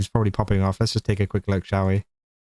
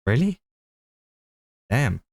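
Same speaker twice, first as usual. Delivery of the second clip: surprised; 3 semitones higher than usual. Real, not acted. Reacting to she's British.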